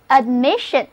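"admission" with a stressed MIS; stress on second syllable. Shown as a demonstration. Teacher models "admission" for learners to repeat, stressing MIS. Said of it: "重音还是放在第二个音节上".

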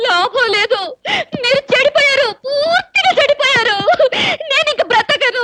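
high-pitched comic sobbing; tearful wailing. Acted. Wife despairing over her smoking husband, tearful wailing. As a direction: wail it high, dragging out the despair. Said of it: "నువ్వు మరీ అలా ఏడుస్తుంటే".

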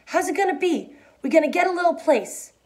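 put-on hopeful and childlike; eager childlike. A narrator's reading. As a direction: ask it like an excited child. Lenny eager about dream.

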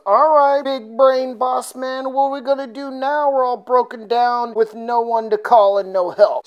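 mock exasperated rant; sarcastic comeback. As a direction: jeer at the boss who got them stuck. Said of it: "[name] responds".

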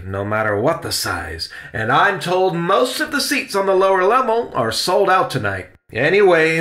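rally with full stage projection; grand, emphatic theatrical uncle; resolute Uncle Riley. Acted. Uncle rallies, announces critic.

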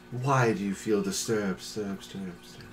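echoing disembodied voice fading out; unearthly voice. Acted. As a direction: voice in your head, echoing away. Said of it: "disembodied voice in your head".

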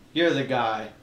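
normal talking voice

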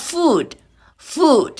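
"food" with long oo, high then falling; model pronunciation. Shown as a demonstration. Teacher models "food" for students, model pronunciation. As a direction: pronounce "food" with a long vowel and final d. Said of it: "อ่านออกเสียงไม่เหมือนกันนะ".

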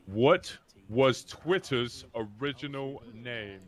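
slow, drawn-out quiz question; deliberate, stretched-out question reading; slow repeat. Real, not acted. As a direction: space out each word for players who missed it. Repeats question after being misheard.

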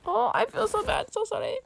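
high, sing-song apology; high apologetic whine. Real, not acted. Player declining a trade, high cutesy apology. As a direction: pitch it up, cutesy and sorry.

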